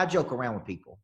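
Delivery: ordinary delivery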